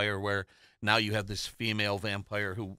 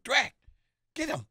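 much higher frantic yelp; panicked yell. Retold or imitated. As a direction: sell him out in a panic. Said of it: "he's gonna trip that guy and go".

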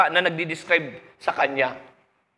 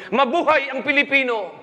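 high laughing patriotic cry; mock rallying shout, higher; 7 semitones higher than usual. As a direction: cheer it out with a laugh in it. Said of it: "biglang sumigaw ang Pilipino".

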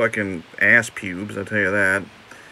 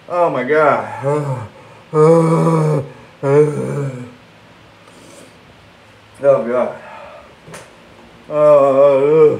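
loud strained groans of burning pain; 3 semitones higher, 5 dB louder, much slower than usual. Real, not acted. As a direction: groan out the spicy burn.

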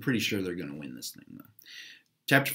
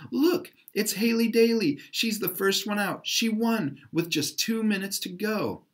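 high, excited crowd cry; excited screaming; 11 semitones higher than usual. A narrator's reading. A fan in the crowd screams as Haley climbs out, high. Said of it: "started screaming".

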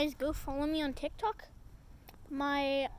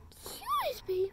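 high coo; 8 semitones higher than usual. Real, not acted. Fawning over the baby, high baby talk. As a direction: coo it fondly.

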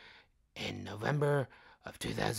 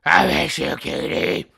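loud mock lament; 5 semitones higher, 14 dB louder than usual. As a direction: overplay missing her. Mourns Katie Holmes leaving.

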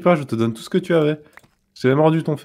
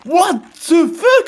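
shocked; 10 semitones higher, 3 dB louder, much slower than usual. Real, not acted. Sudden explosion nearby.

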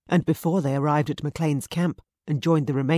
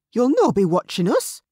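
higher, louder scornful taunt; snarling defiance; 7 semitones higher, 6 dB louder than usual. A narrator's reading. Mary defying the guard, higher and louder snarl. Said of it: "as she snarled".

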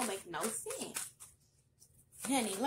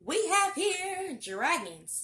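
slow, louder and higher announcement; dramatic card reveal; 6 semitones higher, 6 dB louder, much slower than usual. Acted. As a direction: unveil the card like a grand show. Tarot card reveal to viewers.

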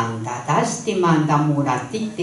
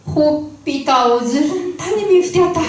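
laughing; 11 semitones higher, 5 dB louder, faster than usual. A narrator's reading. Narrator laughs at bride.

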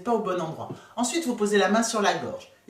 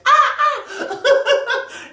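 high, loud squawking laugh; seagull laugh; 8 dB louder than usual. Shown as a demonstration. Coach likens her laugh to a gull, squawking laugh. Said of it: "ça fait un peu la mouette".

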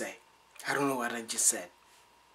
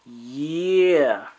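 loud, long swooping "Yeah"; imitating her reply; 10 dB louder than usual. Retelling what she was like, loud drawn-out mimicry. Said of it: "Then she was like".

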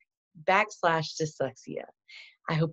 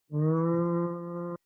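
R sound demo. Shown as a demonstration. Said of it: "Let's make it together".

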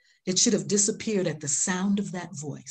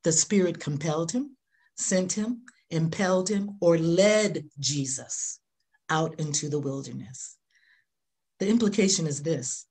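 ordinary talk

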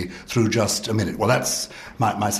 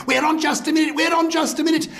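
high-pitched excited squeal; Kenneth Williams screaming. Retold or imitated. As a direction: imitate an overexcited showman, squealing very high. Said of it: "dancing and screaming and saying".

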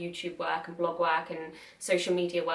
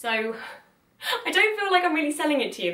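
higher, louder and quicker, laughing through the words; laughing aside; 8 semitones higher, 6 dB louder, faster than usual. Real, not acted. Vlogger laughing at herself to her viewers, laughing and quick. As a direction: laugh it off at her own pitch.

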